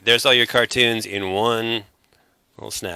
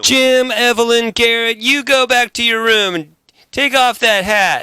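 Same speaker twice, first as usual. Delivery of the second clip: high, hammed-up Southern scolding; Southern parent; 10 semitones higher than usual. Bandmate scolding imaginary kids, hammed-up Southern accent. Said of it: "I just got all country in here".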